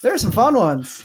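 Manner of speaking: high, loud and lively; excited. Real, not acted. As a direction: keep the eager energy. Keeps the excited tone.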